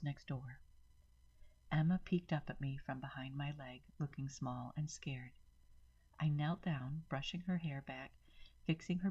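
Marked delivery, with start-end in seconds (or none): none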